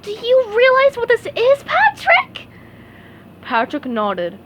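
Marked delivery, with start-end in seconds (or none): 0.0-2.5 excited. A narrator's reading. SpongeBob trembling with excitement.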